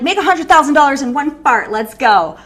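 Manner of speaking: silly hyped-up promo; mock hype parody. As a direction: parody the guru ad, cheeky. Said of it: "I'm like".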